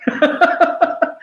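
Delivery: high, breathy burst of laughter; real laugh. Real, not acted. Guest confessing he was a bad person, laughing. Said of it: "I'm laughing about that".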